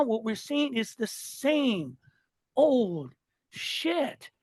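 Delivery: normal talking voice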